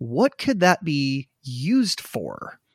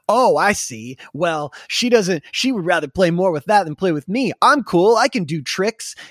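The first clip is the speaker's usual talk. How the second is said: lightly voiced jealous goldfish, a bit louder; sulky goldfish voice; 3 semitones higher, 6 dB louder than usual. Host retelling an ad's jealous goldfish, lightly voiced and louder. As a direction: whine as the neglected pet fish, half-hearted. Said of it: "there's a goldfish talking in this weird, like, accent".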